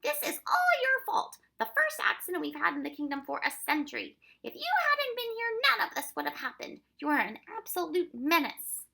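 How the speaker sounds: high, loud telling-off; annoyed scolding. A narrator's reading. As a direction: scold the children at full volume. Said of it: "in annoyance".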